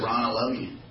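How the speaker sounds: soft, gentle "Ron, I love you"; tender voice of God. Retold or imitated. God calling the preacher by name, softly and tenderly. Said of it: "God called me and said".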